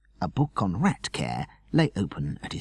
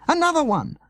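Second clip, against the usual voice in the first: much higher excited cry; retold shout; 4 dB louder than usual. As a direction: yell it like the boy did.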